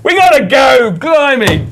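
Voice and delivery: loud, high holler over the others; real shouting. Real, not acted. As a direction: shout to break up the chatter. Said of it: "why you just keep shouting".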